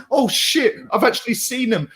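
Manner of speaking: high-pitched mimicry of a rattled heckler; mock nervous panic. Mocking a Newcastle heckler who spots him in person, mock nervous. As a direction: imitate someone startled and jittery. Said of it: "all shaky hand".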